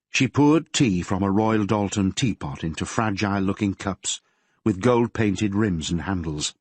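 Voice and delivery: ordinary delivery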